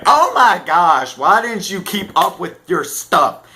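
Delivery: loud, high-pitched acted scolding; pissy outburst. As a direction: snap at a slacker in mock exasperation. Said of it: "I get a little bit pissy".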